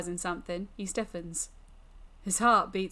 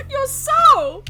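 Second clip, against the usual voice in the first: high, loud, hilarious disbelief; mocking shriek through laughter; incredulous mockery; 14 dB louder than usual. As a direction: shriek it back at him, cracking up. Demon cackles at his fear.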